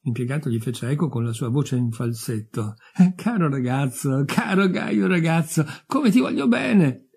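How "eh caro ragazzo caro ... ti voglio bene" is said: slow, cooing gush climbing high; ecstatic squeal. A narrator's reading. Advotia adores the young newcomer, squealing in ecstasy. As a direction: swoon over the boy, stretching the words. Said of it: "strillò estasiata Advotia Ignatevna".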